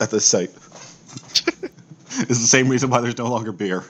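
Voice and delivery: ordinary talk, nothing marked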